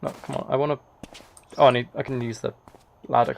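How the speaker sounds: ordinary talk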